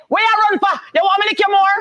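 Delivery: high scolding yell; scolding parent. Retold or imitated. Old-school parent scolding a fleeing child, high yell.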